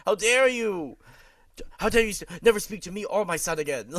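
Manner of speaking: high, loud hammed-up indignation; theatrical pretend fury; mock parental outrage. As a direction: scold like an offended mother, playfully. Acts as outraged parent, laughing after.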